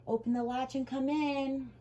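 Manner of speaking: wolf puts on a high old-lady voice; wolf imitating Grandma. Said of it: "imitate Grandma's quavering little voice".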